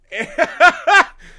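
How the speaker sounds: high, loud burst of laughter; big real laugh. Real, not acted. Telling his cohost the plot, bursts out laughing. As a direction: crack up at the joke.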